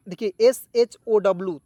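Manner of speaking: ordinary talk, nothing marked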